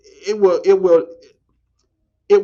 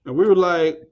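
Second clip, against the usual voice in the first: ordinary talk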